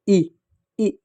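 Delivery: "ई" said short, as a quick "i"; brief, isolated "ई" for teaching; short vowel. Shown as a demonstration. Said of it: "छोटी ई".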